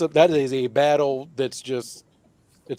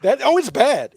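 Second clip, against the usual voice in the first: mock dismay; 10 semitones higher, 4 dB louder than usual. Real, not acted.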